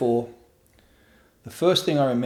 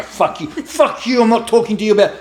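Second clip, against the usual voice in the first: high, loud defiant tirade; angry outburst; 10 semitones higher, 6 dB louder than usual. Acted. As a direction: slam the door on the therapist, loudly. Acted defiant outburst at therapist.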